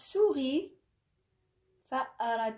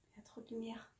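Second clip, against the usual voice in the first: whisper; 14 dB quieter, faster than usual. Real, not acted. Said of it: "aside to herself about light".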